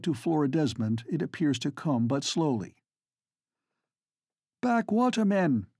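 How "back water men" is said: shout. Acted. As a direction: shout orders to the boat. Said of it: "she shouts".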